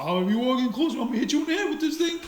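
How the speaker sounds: high, rushed mocking voice, half laughing; mimicked threat. Driver imitating the man threatening with an axe handle, mocking. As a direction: imitate the other man's bluster.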